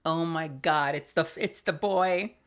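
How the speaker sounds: ordinary talk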